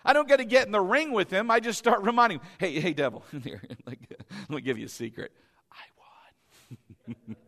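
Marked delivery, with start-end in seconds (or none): 2.6-7.4 mock secret whisper. Taunting the devil in secret.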